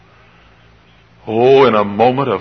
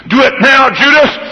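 high, louder push; urging tempter; 13 semitones higher, 4 dB louder than usual. Acted. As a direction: egg him on. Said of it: "ping-pong ball going side to side".

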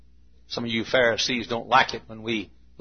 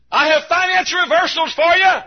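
high-pitched preacher's shout; acted high shout from the world; 5 dB louder than usual. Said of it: "The world shouts across the deep".

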